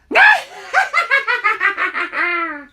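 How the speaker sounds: high shrieking witch cackle. Acted. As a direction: cackle like an old hag. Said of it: "How was my witch laugh?".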